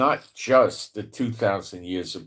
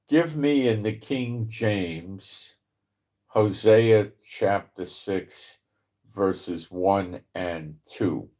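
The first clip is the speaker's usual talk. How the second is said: slow voice command; slower than usual. Real, not acted. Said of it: "just tell A I".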